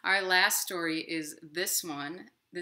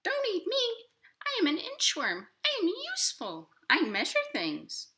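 much higher squeaky little worm voice; tiny inchworm; 4 dB louder than usual. Acted. Inchworm begging the hungry robin not to eat him, high and squeaky. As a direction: plead as a tiny bug. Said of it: "said the robin".

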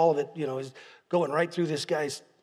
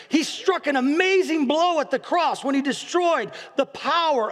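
preaching at full force; 5 semitones higher than usual. Real, not acted. Preacher rallying the congregation about the mighty warrior, full-force preaching. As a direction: lift it up like a revival preacher.

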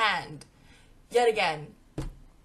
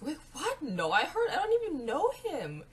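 flustered denial; 6 semitones higher than usual. Retold or imitated. Denying friends' teasing about boyfriend.

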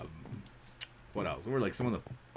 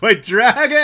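high, loud burst with laughter; excited laughing shout; 10 semitones higher, 14 dB louder than usual. Real, not acted. Player reacting to a Blue-Eyes pull, excited laughing shout.

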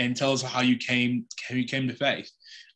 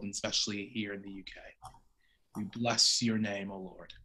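scripture read, then a hushed, softer prayer; 3 semitones lower, 5 dB quieter than usual. Real, not acted. Host praying for the guest before the conversation, hushed prayer. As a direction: pray over the evening gently and reverently.

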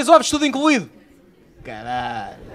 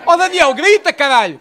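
calling out; 6 semitones higher, 4 dB louder, slower than usual. Real, not acted. Comedian calling to Daniel at the back, loud. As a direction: call up to the back, rising on grita.

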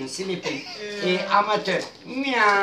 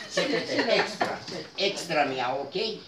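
whisper; 6 semitones lower, 9 dB quieter than usual. Acted. Aside while leading the game.

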